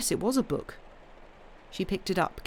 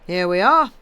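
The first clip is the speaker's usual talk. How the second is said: lightly voiced jovial husband, louder; a bluff man teasing his wife; hearty husband; 11 dB louder than usual. A narrator's reading. Henry teases Anne in the garden, lightly hearty.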